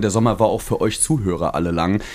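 ordinary talk

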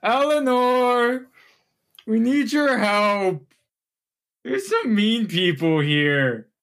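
high, slow pleading ogre call. Acted. Hurt ogre calling his big sister for help, high slow plea.

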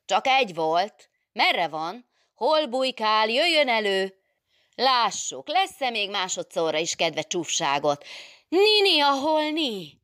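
high, loud hunting calls, building to a shriek; fighting challenge. Acted. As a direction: call the intruder out, daring him. Adél hunting the intruder, then spotting him.

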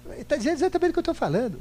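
high, rushed, insistent comeback; triumphant retort. Retold or imitated. Answering the pastor about the Bible, fast and high. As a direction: fire back fast and high, sure you've won. Speaker's retort in retold argument.

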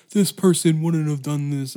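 loud mock nerd complaint; mocking fans. Mocking Star Wars fans' complaints, loud and whiny. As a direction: parody an outraged fan. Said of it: "They're like".

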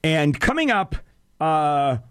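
ordinary delivery

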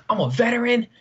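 lightly voiced angry stranger; mock indignant guy. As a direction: play up his indignant claim. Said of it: "He's like".

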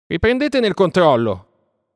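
high, loud, slow cry of scorn; scornful shout. A narrator's reading. Mowgli to the villagers, shouting scornfully. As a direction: throw the words out loudly with contempt. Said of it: "gridò Mowgli sprezzantemente".

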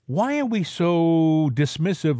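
normal talking voice

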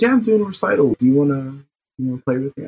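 man doing a woman's voice. Retold or imitated. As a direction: put on a high girl's voice. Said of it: "that's my default girl voice".

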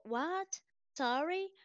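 uncomprehending American. Acted. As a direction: act out someone who didn't catch it. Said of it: "Aí ele fala".

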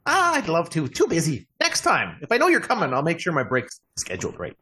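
high, chatty gnome voice; busy little gnome hostess; friendly gnome. Acted. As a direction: turn them down cheerily in a high little voice. Plays gnome Sarah.

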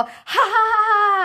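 exaggerated, long high fake laugh. Friend faking a laugh to annoy the boyfriend, high drawn-out fake laughing. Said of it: "I'm gonna start fake laughing".

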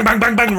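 loud, high bangs like shots; mock gunshots. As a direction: punch each bang out like gunfire. Retelling human shield shootout.

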